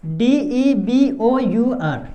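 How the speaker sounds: letter by letter, very slowly; slow spelling. Shown as a demonstration. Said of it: "इसको क्या पढ़ेंगे".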